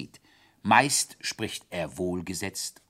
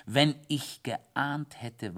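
plain reading voice

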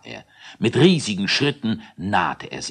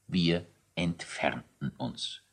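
soft understatement; 3 semitones lower, 10 dB quieter than usual. A narrator's reading. Narrator's comic understatement.

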